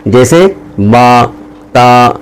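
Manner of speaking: با drawn out to one alif length; drawn-out vowel. Shown as a demonstration. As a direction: stretch با and تا slowly. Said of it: "کھینچ کر پڑھیں گے".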